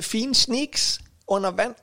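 ordinary delivery

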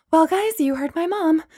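out of breath; breathless. A narrator's reading. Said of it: "Bree said breathlessly".

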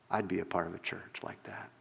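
low, near-whispered admission; very soft, low, sincere acted reply; hushed confession. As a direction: drop to a heartfelt whisper. Harry's moved confession, acted.

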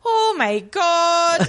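high, very drawn-out mock exclamation; imitating Daisy's odd tone. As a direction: mimic her, stretching each word way up high. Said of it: "anything in this tone before".

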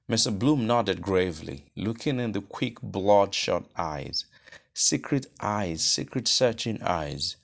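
ordinary delivery

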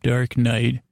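usual speaking voice

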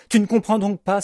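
high, loud, panicky protest; horrified. A narrator's reading. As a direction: exclaim it in dramatic horror. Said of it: "s'épouvante Jean-Hector".

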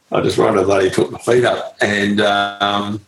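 ordinary talk, nothing marked

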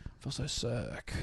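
whispered, pitiful fake-sick plea; mock sick whisper. Apprentice begging to go home, a pitiful fake-sick whisper. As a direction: whisper it like you're milking a sick day.

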